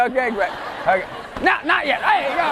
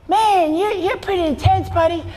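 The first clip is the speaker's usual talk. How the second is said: put-on high-pitched kid voice; 6 semitones higher than usual. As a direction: pipe up like a kid in the audience. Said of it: "there was a kid who came up to me".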